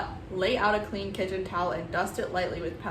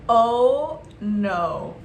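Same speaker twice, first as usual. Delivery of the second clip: loud dismay; 8 dB louder than usual. Real, not acted. Cake breaking.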